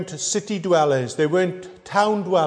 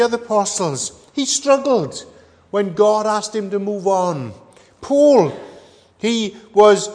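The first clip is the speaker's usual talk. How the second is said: higher, louder sermon build; impassioned preaching; 5 semitones higher, 4 dB louder than usual. Acted. Preacher telling the congregation about Peter and Paul, rising impassioned preaching. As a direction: lift the congregation with rising fervour.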